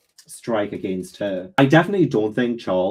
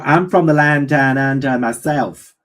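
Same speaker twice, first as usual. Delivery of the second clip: put-on a fleeting, half-hearted Australian accent, slower; light put-on Australian accent; mock Australian accent; 3 semitones higher, 3 dB louder, slower than usual. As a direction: fake being from down under and drop the Australian accent fast. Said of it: "That accent went way so quickly".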